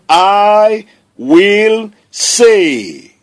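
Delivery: drawn-out, every word weighted; slow emphatic declaration. Shown as a demonstration. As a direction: declare it slowly and firmly. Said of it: "What will you do?".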